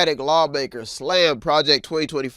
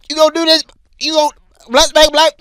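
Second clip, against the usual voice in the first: put-on higher, louder teasing kids' voice; egging-on cousins; 12 semitones higher, 7 dB louder, faster than usual. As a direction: goad him like excited cousins.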